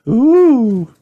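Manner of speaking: high, loud "ooh" of wonder; amazed gasp. A narrator's reading. Wendy hears of Peppa's magical adventure, amazed gasp. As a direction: gasp like an impressed little girl. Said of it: "gasped Wendy".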